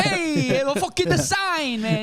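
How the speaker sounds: high, loud put-on New Yorker; mock New York yell. Pedestrian reenacts yelling at the taxi, high mock New Yorker. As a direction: rant like a tough guy in the street.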